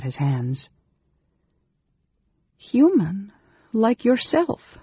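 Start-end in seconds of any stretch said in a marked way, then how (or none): 2.6-4.8 put-on high-pitched girl's protest. A narrator's reading. Girl insists to the mage she is human, protesting. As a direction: protest in a raised, girlish pitch. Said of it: "she protested".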